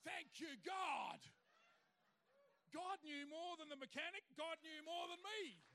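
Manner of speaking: loud, high, exultant cry of thanks; triumphant shout. Real, not acted. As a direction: shout it out in praise. Triumphant climax of testimony.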